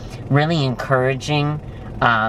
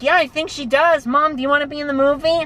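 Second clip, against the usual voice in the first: much higher, fast and eager; a kid's quick, high question; eager young self; 13 semitones higher, faster than usual. Retold or imitated. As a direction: rush it like an excited kid asking his mom. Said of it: "And I was like".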